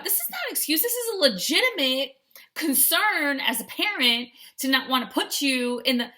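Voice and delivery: high, indignant protest; mock-offended parent protest. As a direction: defend yourself, offended. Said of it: "And then I thought".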